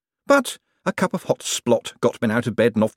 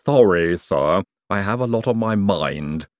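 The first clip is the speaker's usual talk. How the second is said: lisping Igor; slower than usual. A narrator's reading. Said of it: "your lisp just departed".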